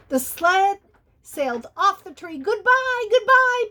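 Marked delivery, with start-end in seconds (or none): 2.4-3.7 very high, loud farewell call; calling goodbye. Acted. Benny calling goodbye as the sled leaves the tree, high. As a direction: sing out the goodbyes as he sails off. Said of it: "Benny called".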